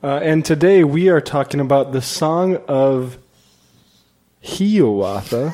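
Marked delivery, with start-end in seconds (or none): 4.4-5.5 Hiawatha said slowly, as Longfellow said it; slow, careful Hiawatha; Longfellow's pronunciation. Shown as a demonstration. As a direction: draw out Hiawatha the old way. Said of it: "is how Henry Wadsworth Longfellow pronounced it".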